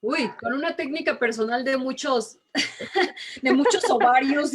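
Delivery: laughing while answering; a light, amused laugh at the question; real laughing. Real, not acted. (riendo mientras responde)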